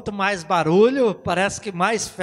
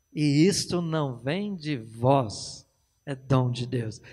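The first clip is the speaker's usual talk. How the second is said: slow, lower and softer verse; solemn scripture; 6 semitones lower, 4 dB quieter, slower than usual. Shown as a demonstration. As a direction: let the verse sink in low and slow. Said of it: "tá escrito assim".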